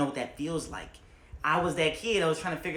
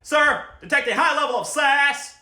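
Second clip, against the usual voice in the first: high, loud quoted line; lightly voiced cartoon line; quoting movie character; 12 semitones higher, 11 dB louder than usual. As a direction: mimic the movie character loosely. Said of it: "or, like".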